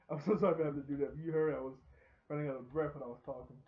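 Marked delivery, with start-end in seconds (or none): 0.1-1.2 loud, fast, laughing through it; laughing apology. Real, not acted. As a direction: giggle out the apology. Laughing apology after breathing break.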